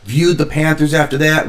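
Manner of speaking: ordinary talk, nothing marked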